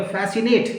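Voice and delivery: फैसिनेट with only an S sound, no K; silent C. Shown as a demonstration. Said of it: "सी आप बोला नहीं जाएगा".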